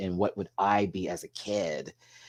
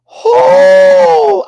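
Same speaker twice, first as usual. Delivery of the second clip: very high, loud "oh" cry; emotional wail; 20 dB louder than usual. Real, not acted. Reviewer overcome by the performance, emotional wail. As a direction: let out a piercing, overcome whoop. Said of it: "I got emotional".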